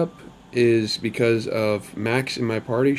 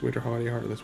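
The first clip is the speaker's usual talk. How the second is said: quick, softer rattled-off repeat; fast tongue twister; 6 dB quieter, much faster than usual. Shown as a demonstration. Vlogger trying a tricky enemy name three times fast, rapid. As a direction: rush through it under your breath like a tongue twister. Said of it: "Try to say that three times fast".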